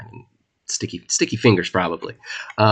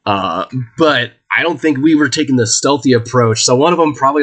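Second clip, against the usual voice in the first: ordinary talk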